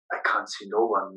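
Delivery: ordinary talk, nothing marked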